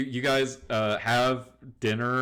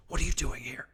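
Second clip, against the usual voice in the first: urgent secret whisper; 4 semitones higher, faster than usual. Acted. Daughter confronts guest out of father's earshot, whispered. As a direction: whisper it so nobody upstairs hears.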